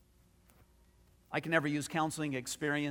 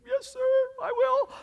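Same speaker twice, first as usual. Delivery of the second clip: meek reply; 7 dB louder than usual. Retold or imitated. Answering God in dad talk.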